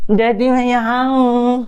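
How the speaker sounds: put-on a small boy answers his dad; child's voice.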